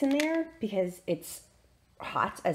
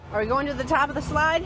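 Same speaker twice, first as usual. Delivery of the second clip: playful parent talk; 7 semitones higher, 5 dB louder than usual. Real, not acted. Mom to little boy at the slide, playful. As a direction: ask brightly, pitched up for a little one. Playing with small child at slide.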